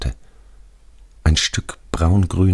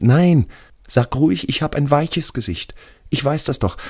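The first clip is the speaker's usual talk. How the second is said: sehr hohe, beharrliche Stimme; ängstlicher toter Schulfreund; 13 semitones higher than usual. Acted. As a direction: als toter Freund widersprechen, deutlich höher. Radi corrects narrator. (hoch, mit lautem Einsatz widersprechend)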